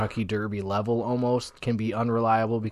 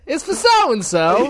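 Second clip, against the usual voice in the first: high, loud, drawn-out character voice; mock bookie voice; 9 dB louder, slower than usual. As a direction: stretch it out in a hammed-up high voice. Mimics the bookie's errand line.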